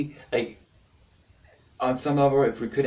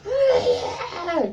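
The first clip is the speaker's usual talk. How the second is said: very high squealed "ah"; short hammed-up shriek; mock freak-out scream; 4 dB louder than usual. His own overreaction to rejection, high mock scream. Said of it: "for me, I'm like".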